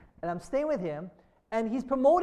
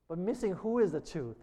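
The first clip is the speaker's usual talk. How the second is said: ordinary talk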